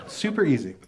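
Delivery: usual speaking voice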